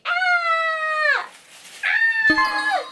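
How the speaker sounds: high delighted shriek; excited scream. Real, not acted. Finding her dream shoes, high joyful shriek. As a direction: shriek with joy.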